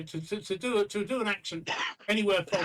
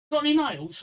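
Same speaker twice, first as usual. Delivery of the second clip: high, louder duck's question; cheeky duck; 8 semitones higher, 7 dB louder than usual. Acted. Duck testing the barman who threatened it, high and cheeky. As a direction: pipe up as the pestering duck. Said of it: "the duck walks up to the bar and says".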